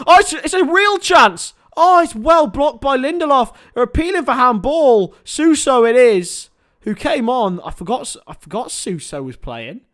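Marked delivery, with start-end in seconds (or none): 0.1-7.6 high, loud near miss call; excited near miss. Real, not acted. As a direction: call the chance as it happens. Real chance, appealing.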